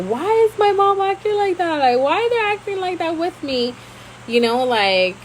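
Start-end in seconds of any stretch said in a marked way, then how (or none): 0.0-3.7 a lightly voiced whiny complaint, higher; retold complaint. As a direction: replay her old hurt protest, pitched up. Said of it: "and I was like".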